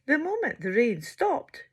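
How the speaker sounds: ordinary delivery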